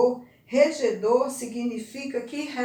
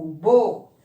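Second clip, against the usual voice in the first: ordinary delivery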